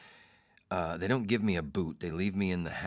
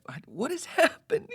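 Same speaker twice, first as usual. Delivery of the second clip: high, loud, laughing "what is happening"; a baffled laugh breaking through the question; laughing disbelief; 7 dB louder than usual. Real, not acted. Disbelief at the assistant.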